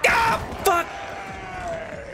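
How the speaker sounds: high sudden cry; startled scream. Real, not acted. Gamer caught by the monster, startled scream. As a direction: yelp and curse in fright.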